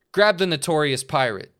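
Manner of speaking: commanding Santa. Acted. Santa orders.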